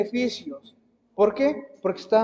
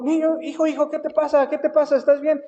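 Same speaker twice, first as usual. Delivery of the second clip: put-on anxious, higher pleading questions to a child; a parent's frightened concern; worried parent; 6 semitones higher than usual. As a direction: rush in alarmed, asking what's wrong. Worried parents rush to crying son.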